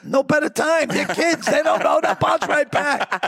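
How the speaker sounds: lightly voiced breezy reasoning; breezy high-pitched justification; high mock voice.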